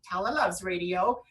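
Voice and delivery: ordinary talk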